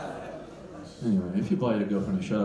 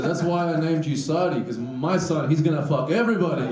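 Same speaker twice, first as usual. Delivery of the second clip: louder, higher proud declaration; proud dad; 7 semitones higher, 4 dB louder than usual. Retold or imitated. Dad proudly telling his son why he named him, louder. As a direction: boom it like a proud father. Retelling proud dad's line.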